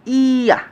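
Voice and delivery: ইয়া sounded as a long i gliding into a, high and loud; pronunciation demo. Shown as a demonstration. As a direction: model ইয়া clearly, high ii into open aa. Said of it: "তাহলে উচ্চারণ হবে কি".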